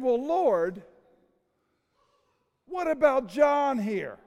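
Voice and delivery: indignant Peter. Retold or imitated. Peter objects to Jesus about John, loud and indignant.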